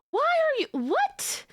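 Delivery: high, incredulous squeal at the cheese; mock outrage. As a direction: act scandalized by being slapped with cheese.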